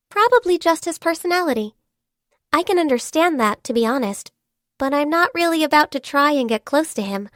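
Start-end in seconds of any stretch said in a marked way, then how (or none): none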